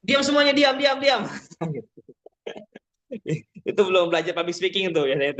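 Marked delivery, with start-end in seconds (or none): 0.0-1.2 high-pitched mock telling-off; scolding hush. Shown as a demonstration. Untrained speaker silencing laughing participants, high scolding voice. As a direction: badger everyone into silence. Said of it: "jangan, jangan pakai bahasa".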